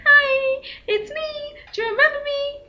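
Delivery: very high squeaky greeting; exaggerated chummy driver. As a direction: overplay his familiarity. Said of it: "unless he's like".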